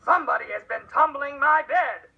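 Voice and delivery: loud growling papa bear; booming big bear voice. A narrator's reading. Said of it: "the big bear growled".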